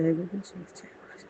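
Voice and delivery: whispered devotion; whispered closing of the chant. Real, not acted. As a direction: breathe it as a hushed prayer.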